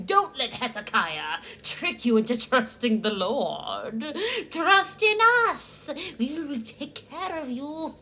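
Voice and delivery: hammed-up Assyrian officials taunting the people of Jerusalem; mock menacing officials. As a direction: lure the crowd with fake kindness, slowly. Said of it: "shouting at the people saying".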